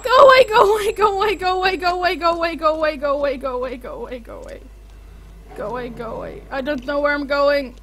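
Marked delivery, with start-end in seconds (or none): 0.0-1.4 loud high screams for it to leave; terrified screaming. Real, not acted. Gamer cornered by the monster, screaming at it. 1.4-6.4 fading frightened pleading. Real, not acted. Chanting as fear fades.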